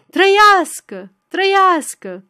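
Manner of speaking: loud, high, drawn-out cheer; partisans cheering. A narrator's reading. Partisans backing the candidate against the officer, shouted cheers. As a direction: chant support at full voice.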